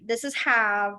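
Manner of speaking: ordinary talk, nothing marked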